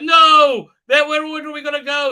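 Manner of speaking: high, loud, fast protest; hammed-up desperate outcry; mock panic. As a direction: wail no, then rush out the frantic question. Imitating protesting villagers.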